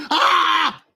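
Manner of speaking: sharp high scream of terror. Acted. Victim screaming at home invaders, high shriek.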